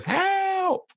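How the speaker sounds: comic falsetto cry for help; high-pitched scream. Acted. Memory teacher imitates tiny man dangling from beard, high-pitched mock scream. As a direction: squeal it out very high, in playful mimicry. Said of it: "screaming his high-pitched voice".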